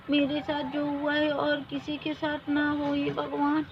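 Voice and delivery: woman praying to God in the river; prayer. Acted. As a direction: pray quietly with folded hands. Praying while bathing in river.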